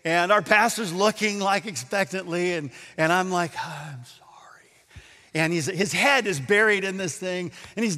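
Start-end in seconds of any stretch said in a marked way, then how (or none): 3.6-4.7 acted whispered apology; sheepish apology. Doubter praying while the pastor looks on, sheepish whisper.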